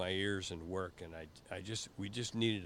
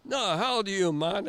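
high, loud excited island voices; much higher, louder mock island crowd; Jamaican beach guys; 10 semitones higher, 9 dB louder than usual. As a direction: beg for the picture with delight. Guys ask his age.

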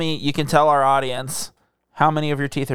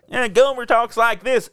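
imitating Gomer; 8 semitones higher than usual. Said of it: "the whole bit on Andy Griffith".

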